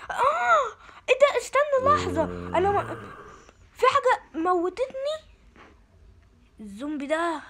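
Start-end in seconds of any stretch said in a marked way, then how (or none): 0.1-5.2 high, loud startled cry; shocked yelp. Real, not acted. Sudden death in game. (صرخة مفاجأة عالية)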